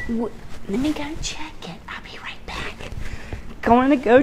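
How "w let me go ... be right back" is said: soft unvoiced aside; whisper. Real, not acted. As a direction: whisper it while slipping away. Slips away to check cake.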